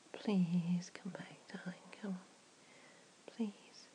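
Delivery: slow, whispered pleading; whispered begging, darling; soft plea. Acted. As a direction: coax him back in a hush. Pleading softly for return.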